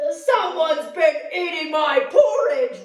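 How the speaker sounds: Papa Bear discovering the porridge, loud roar. Acted. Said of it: "roared Papa Bear".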